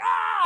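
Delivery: very high scream; mock screaming. Woman keeps trading screams, high mock scream. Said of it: "we're just screaming".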